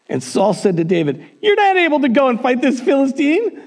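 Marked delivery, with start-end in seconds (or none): 1.4-3.6 high-pitched disbelief; acted incredulous king dismissing a youngster; incredulous scoffing. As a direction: scoff at the very idea, voice shooting up. Saul scoffs at young David.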